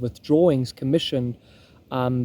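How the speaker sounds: ordinary talk, nothing marked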